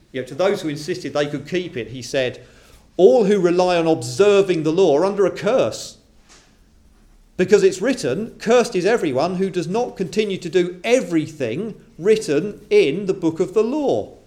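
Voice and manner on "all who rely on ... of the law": higher and louder, a solemn pronouncement; forceful preaching. Acted. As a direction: thunder the verse at the congregation. Preacher declaims Paul's curse verse.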